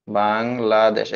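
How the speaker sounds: "Bangladesh" slowly broken as বাং, লা, দেশ; measured three-part "Bangladesh" for counting; split into syllables. Shown as a demonstration. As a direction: separate each syllable of "Bangladesh". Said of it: "এখানে কয়টা syllable".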